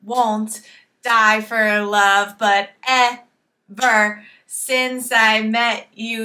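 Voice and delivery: very slow, punched, evenly measured syllables; spoken lyric spaced out to show its rhythm; repeated rhythm. Shown as a demonstration. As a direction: chant the lyric slowly on the beat. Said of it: "we're kind of repeating this rhythm".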